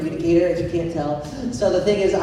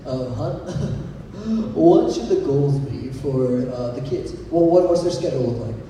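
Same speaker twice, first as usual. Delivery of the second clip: dad talking to mom; 6 semitones lower than usual. Retold or imitated. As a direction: play the dad mumbling to his wife, lightly. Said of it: "my dad turns to my mom and says".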